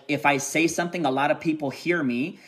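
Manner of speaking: usual speaking voice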